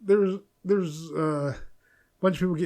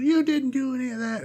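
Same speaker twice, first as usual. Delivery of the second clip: mocking the critics; 7 semitones higher than usual. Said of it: "And they were like".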